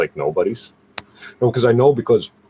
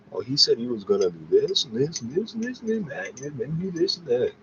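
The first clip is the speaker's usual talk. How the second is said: high, soft, mincing mock voice of a tidy talker; mocking prissy storyteller; 6 semitones higher, 6 dB quieter than usual. Mocking how other people tell neat stories, high and soft. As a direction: lilt through the list like a know-it-all gossip. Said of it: "They've got these perfectly fucking".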